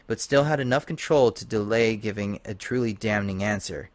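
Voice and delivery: usual speaking voice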